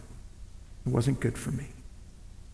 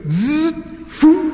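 mock throwing noise; 8 semitones higher than usual. Wife throws cake out window.

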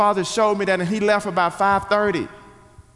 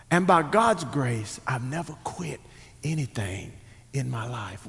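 hushed sincerity; 8 semitones lower, 8 dB quieter than usual. Real, not acted. Preacher recalling his father's lesson, hushed and sincere. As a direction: let each phrase settle gently, with long pauses.

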